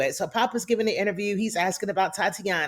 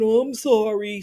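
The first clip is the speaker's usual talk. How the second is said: mock apology; 3 semitones higher, 3 dB louder than usual. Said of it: "just saying".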